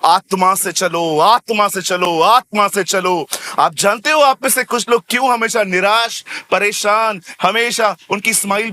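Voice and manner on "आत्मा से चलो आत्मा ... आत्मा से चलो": आत्मा stressed slowly each time; repeated slow emphasis. Real, not acted. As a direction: drive home आत्मा से चलो slowly. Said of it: "चिल्ला चिल्ला के एक ही बात समझाई है".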